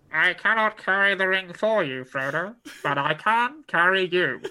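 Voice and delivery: really nasal old-radio impression; nasal radio effect. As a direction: declaim it through the nose like an old broadcast. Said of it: "I'm doing a really nasal thing".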